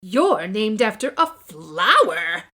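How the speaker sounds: loud, slowed taunt, stress on a; mocking sneer. Victoria taunting Chrysanthemum, loud and slow. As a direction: sneer it down at her.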